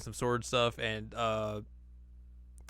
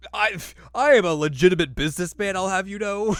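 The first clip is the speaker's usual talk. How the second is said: higher, louder indignant monk; hammed-up indignant voice, loud; Miroku; 8 semitones higher, 10 dB louder than usual. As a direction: huff like an offended con man.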